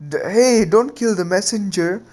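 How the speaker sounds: high squeaky creature yelp of protest; a strange creature's high, flustered plea; panicked Zetsu. Acted. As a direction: squeal it while burning up.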